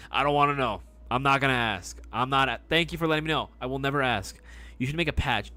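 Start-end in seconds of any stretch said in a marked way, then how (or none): none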